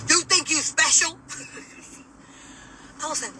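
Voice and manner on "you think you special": high, loud mock officer yell; imitated yelling. Jail officer challenging a detainee, imitated yelling. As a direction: yell it accusingly, mimicking the officer. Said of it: "why are you yelling".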